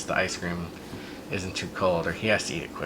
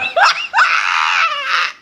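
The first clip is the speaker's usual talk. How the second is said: big high burst of laughter, much louder; 9 dB louder than usual. Real, not acted. Parent cracking up at child's ice cream joke, loud burst of laughter.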